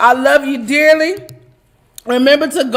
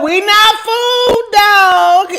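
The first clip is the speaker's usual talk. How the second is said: playful shout; 8 semitones higher, slower than usual. Real, not acted. Calling out viewers, shouting.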